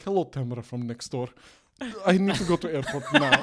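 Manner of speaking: put-on thick Russian accent, rising to urgent demand; Natalie. Russian fiancée asking neighbor for a ride, hammed-up Russian accent. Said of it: "so straightforward 'cause she's Russian".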